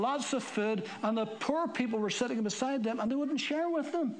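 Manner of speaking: high-pitched, urgent preaching; fiery preaching. Real, not acted. Pastor rousing the church about Corinth, fiery preaching.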